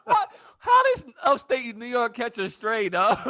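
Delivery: high, loud, drawn-out incredulous yell; laughing outraged shout. Real, not acted. Reacting to upstate New York jab, laughing incredulous shout. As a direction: shout it in amused disbelief.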